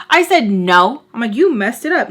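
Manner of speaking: emphatic shouted no; loud refusal. Retold or imitated. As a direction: shut her down loudly. Refusing Barbara the restroom.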